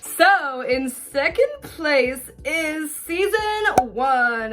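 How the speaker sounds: slow, drawn-out, high announcer build-up; hammed-up big reveal; dramatic reveal. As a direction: stretch it out like a countdown host. Ranking countdown reveal.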